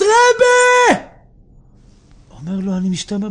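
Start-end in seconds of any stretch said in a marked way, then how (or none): 0.0-1.0 high, drawn-out wailing yell; acted crying shout. Debtor calling to his rabbi in the cellar, wailing shout. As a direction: cry out loudly to the rabbi. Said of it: "ומתחיל לצעוק ולבכות".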